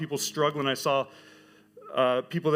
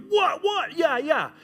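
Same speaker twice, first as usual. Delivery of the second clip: much higher, louder courtside whoop; trash-talk cheering; 14 semitones higher, 5 dB louder, slower than usual. Retold or imitated. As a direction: hype up like a rowdy parent in the stands. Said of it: "I'm cheering".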